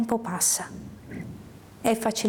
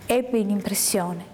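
ordinary delivery

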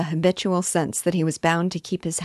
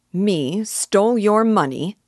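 higher, louder, drawn-out indignant question; angry; 5 semitones higher, 5 dB louder, slower than usual. A narrator's reading. Accused man answering the robbed weaver, angry. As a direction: throw the accusation back, slow and outraged. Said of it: "said Jem angrily".

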